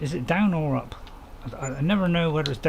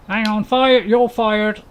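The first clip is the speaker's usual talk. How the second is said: higher and louder, a playful yell; mock shout; 7 semitones higher, 9 dB louder, much slower than usual. Streamer yells at the lazy AI farmhand, mock angry shout. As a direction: bellow at the useless worker, half joking. Yelling at the wonky AI worker.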